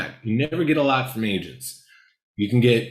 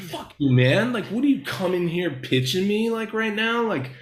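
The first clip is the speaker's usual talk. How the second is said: irritated Ed Harris; 8 semitones higher than usual. Retold or imitated. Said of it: "from his perspective, he's just like".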